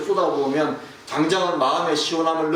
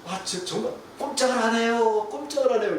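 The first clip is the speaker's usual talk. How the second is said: higher voice, mock whining about the stuck wire; coworker's complaint; 6 semitones higher than usual. Coworker calling from the other side that the wire is stuck, in a higher whiny voice. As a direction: lightly play the coworker groaning it won't budge. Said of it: "계속 그러는 거예요".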